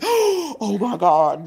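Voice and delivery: shocked laugh on remembering she forgot; shocked realization. Retold or imitated. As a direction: let it dawn on you, laughing.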